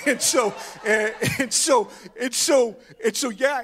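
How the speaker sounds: real laughter spilling into words; laughing. Real, not acted. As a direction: still cracking up at the joke. Laughing after gun joke.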